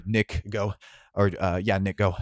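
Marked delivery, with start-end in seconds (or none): none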